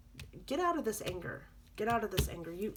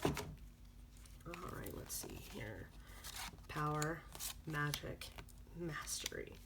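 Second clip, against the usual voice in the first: much softer, half-whispered reading; under breath; 4 semitones lower, 11 dB quieter, slower than usual. Real, not acted. Reader checking the drawn cards, under breath. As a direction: read the cards quietly to yourself.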